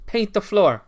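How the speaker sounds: high, loud paint barking the order; barked command. Pretend. Streamer playing the sensei giving the order, loud and high. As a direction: snap it out like a stern sensei. Repeating a Karate Kid line.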